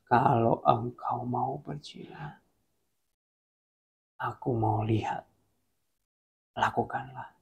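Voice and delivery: calm, hushed divine challenge; spaced out quietly, with long pauses; God's voice. Acted. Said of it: "Dan Tuhan ngomong gini".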